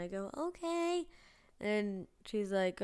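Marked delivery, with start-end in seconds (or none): 0.4-1.1 put-on high-pitched meek kid reply; third grader voice. Third grader hearing of the punishment, high kid voice. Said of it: "freaking idiot third grader".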